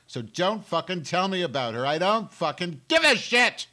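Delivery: loud, high angry yelling; furious shout. Real, not acted. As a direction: blow up at the listeners. Ranting at listeners about Coulter.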